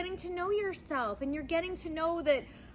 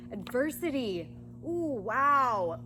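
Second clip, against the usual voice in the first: ordinary talk, nothing marked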